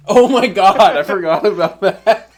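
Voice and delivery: loud, high outburst breaking into laughter; real laughing surprise. Real, not acted. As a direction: burst out as the memory hits you. Suddenly reminded of funny story.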